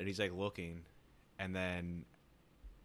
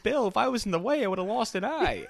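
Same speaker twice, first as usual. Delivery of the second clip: high, loud laughing yell of protest; mock outraged yell; 12 semitones higher, 9 dB louder than usual. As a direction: shriek it like the kid nearly losing an eye, laughing. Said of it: "He's like, he's like".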